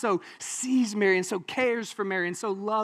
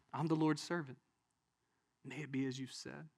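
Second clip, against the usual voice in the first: soft, hushed submission; humble acceptance; 4 semitones lower, 13 dB quieter than usual. Acted. Young Mary answering the angel, humbly hushed. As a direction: surrender quietly and simply.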